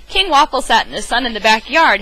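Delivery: ordinary delivery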